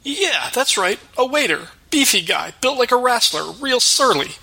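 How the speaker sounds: high, jittery stammering fat cook; nervous cook voice. Acted. Cook voice again.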